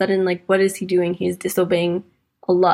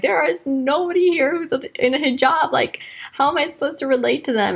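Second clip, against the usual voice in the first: high, exasperated disbelief at the room; pitched-up, incredulous rant to herself; exasperated inner voice; 6 semitones higher than usual. Retold or imitated. Retold thoughts among London Americans.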